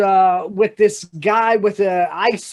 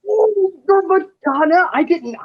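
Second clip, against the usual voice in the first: high, loud surprised squeal; 10 semitones higher, 4 dB louder than usual. Acted. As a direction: gush in surprise at the star. Barman surprised by the celebrity.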